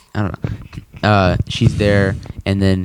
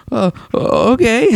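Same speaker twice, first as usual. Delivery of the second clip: Morty voice; 13 semitones higher, slower than usual. Retold or imitated. Retelling Morty's voice.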